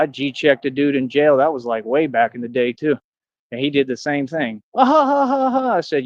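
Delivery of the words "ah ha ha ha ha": high-pitched mock laugh; fake laughter. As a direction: imitate someone's forced, jeering "ha ha ha". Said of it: "That fake laughter".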